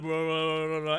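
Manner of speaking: low, subdued wah-wah mumbling; partner's low drone. Retold or imitated. Imitating the straight-man partner, low droning wahs. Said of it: "and he's like".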